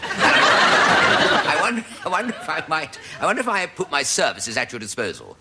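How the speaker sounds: a hint of a posh gentleman horse. Horse politely offering services to chairman, lightly posh. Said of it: "a bit posh".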